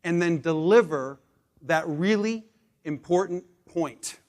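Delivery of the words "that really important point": slow, drawn-out delivery. Shown as a demonstration. Demonstrating slowing down for the key point, slow. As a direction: ease off and land the point. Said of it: "slow all the way down".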